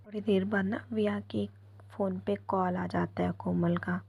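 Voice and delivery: plain reading voice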